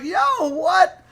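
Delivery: high, loud disbelief; incredulous. Retold or imitated. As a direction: throw it back in loud astonishment. Retold startled reply to Quincy.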